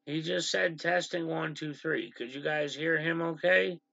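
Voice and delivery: ordinary talk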